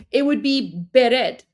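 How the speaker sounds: bered with a vowel between the B and the R, slow; Persian pronunciation. Shown as a demonstration. Teaching the Persian vowel between B and R, slow. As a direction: stretch bread into bered. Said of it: "the vowel between the B and the R".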